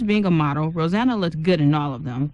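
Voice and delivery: ordinary delivery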